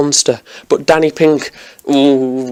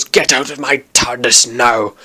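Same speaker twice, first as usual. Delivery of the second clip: bad Scottish accent; 3 semitones lower than usual. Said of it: "That was the worst Scottish accent ever".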